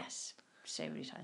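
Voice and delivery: whispered weary aside; a whispered, long-suffering agreement. Real, not acted. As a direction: breathe it out as a tired whisper. Weary aside about repeats.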